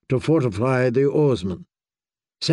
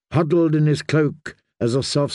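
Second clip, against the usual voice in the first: ordinary delivery, nothing marked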